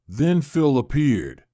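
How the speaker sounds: usual speaking voice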